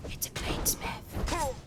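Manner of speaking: slow, breathy, awed; slow whisper. Real, not acted. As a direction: stretch it out in awe. Repeats the find, drawn out.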